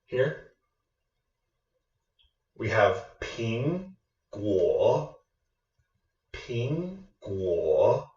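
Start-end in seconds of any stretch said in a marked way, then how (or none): 3.2-5.1 "ping" rising, "guo" dipping then rising; second then third tone. Shown as a demonstration. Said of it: "a second tone". 6.3-8.1 "ping" rising, "guo" dipping then rising; second then third tone. Shown as a demonstration. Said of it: "a second tone".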